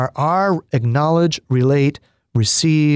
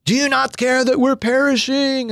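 much higher, urgent cry of a frightened disciple; panicked apostle; 9 semitones higher than usual. Retold or imitated. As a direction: plead like a man afraid of drowning. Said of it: "kind of shakes him and says".

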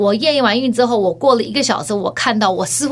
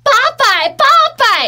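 high, loud repeated cry; excited exclamation; 6 dB louder than usual. Retold or imitated. Doctor phoning patient with the result, excited high cry. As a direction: exclaim the number in amazement.

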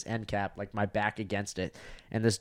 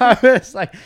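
laughing out the words, much higher and louder; 12 semitones higher, 15 dB louder than usual. Real, not acted. Comic cracking up at his own bombing story, laughing through it. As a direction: crack up mid-line.

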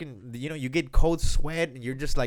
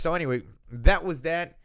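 normal talking voice

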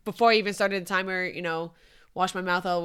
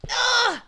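high, loud ugh of outrage; frustrated groan; 8 dB louder than usual. Real, not acted. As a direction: groan like she's been cheated of the answer. Outraged at being teased.

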